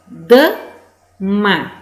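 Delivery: "dama" broken into da, pause, ma; split into syllables. Shown as a demonstration. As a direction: say "dama" as two separate syllables. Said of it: "a primeira sílaba". ("dama" separado em sílabas: da... ma)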